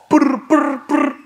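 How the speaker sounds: high rolled brr ad-lib. Retold or imitated. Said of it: "That was my impression of Birdman".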